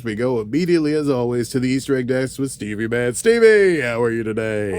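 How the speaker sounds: big hyped-up handover to the desk; game-show announcer. Pretend. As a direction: boom out the segment like a showy announcer. Hosts segment as announcer.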